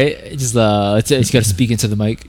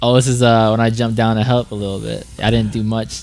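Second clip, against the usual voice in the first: normal talking voice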